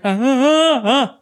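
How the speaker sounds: startled squeaks of ah; man's yelp. Retold or imitated. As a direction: imitate a tough guy yelping. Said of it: "yelp when they're like".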